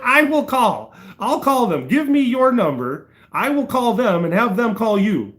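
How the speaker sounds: retold insistence to the dispatcher; firm exasperation. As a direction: take charge, spelling it out.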